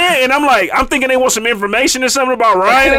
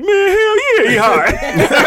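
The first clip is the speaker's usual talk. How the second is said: mock friend; 10 semitones higher, much slower than usual. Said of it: "And K K was like".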